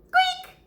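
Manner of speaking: tiny high mouse squeak; a quick squeaky mouse noise. Acted. Squeaking like a tiny mouse hidden in the house.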